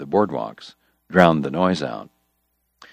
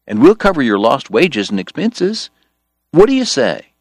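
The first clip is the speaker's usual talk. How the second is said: bright, higher persuasive pitch; eager politician voice; 8 semitones higher, 3 dB louder than usual. Acted. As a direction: sell it like an earnest politician. George's campaign pitch.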